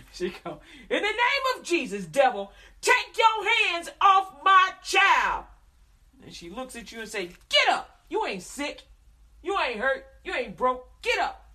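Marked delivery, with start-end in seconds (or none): 0.9-5.5 much higher, slow, shouted rebuke of the devil; praying mama shouting. Retold or imitated. 7.5-11.5 loud, high command to get up; commanding mama. Retold or imitated. Mother commanding child to rise.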